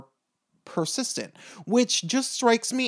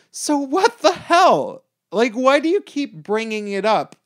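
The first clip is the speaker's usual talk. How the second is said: exasperated; 8 semitones higher, 4 dB louder than usual. Real, not acted. Exasperated outburst.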